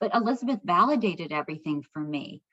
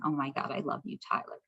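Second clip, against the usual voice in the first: hushed and tender, much softer; 5 semitones lower, 7 dB quieter than usual. Retold or imitated. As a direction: breathe it softly to him, overwhelmed. Whispered thanks to late son.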